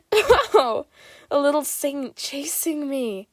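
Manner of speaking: high, sing-song teasing voice. Acted. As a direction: taunt him playfully, up high. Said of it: "he teases".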